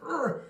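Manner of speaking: high hammed-up brute grunt; cartoonish caveman grunt; mock grunt. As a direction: grunt like a big buff guy charging. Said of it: "grunting all the time".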